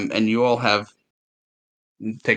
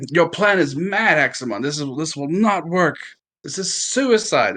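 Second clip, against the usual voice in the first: pleading captive; 7 semitones higher than usual. Acted. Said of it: "It's like".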